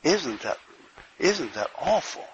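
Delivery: normal talking voice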